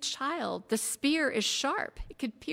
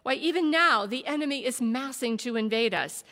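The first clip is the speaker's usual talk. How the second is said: louder, rising heated defence, building; 4 semitones higher, 7 dB louder than usual. A narrator's reading. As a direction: argue harder with each line. Warrior defends himself heatedly.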